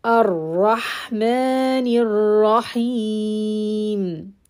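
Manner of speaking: very slow chanted recitation; Quran recitation. Acted. Teacher reciting the third ayah. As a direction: recite the verse slowly and melodically.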